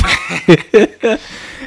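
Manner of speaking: a burst of high-pitched laughter. Real, not acted. Friend cracking up at the retelling, high laughter.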